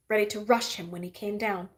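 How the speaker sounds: plain reading voice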